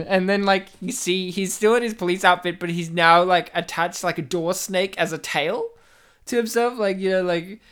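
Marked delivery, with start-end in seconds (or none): none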